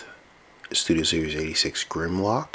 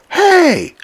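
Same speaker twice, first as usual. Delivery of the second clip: very high, much louder hammed-up yell; outraged squeaky shout; 9 dB louder than usual. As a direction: scream about the lost arm. His arm gets shot off.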